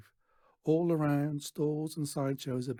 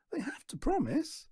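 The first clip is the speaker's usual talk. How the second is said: put-on much higher, innocent boy voice; earnest boy; 13 semitones higher than usual. A narrator's reading. Stan insisting to Natasha, high earnest voice. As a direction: answer simply and sincerely.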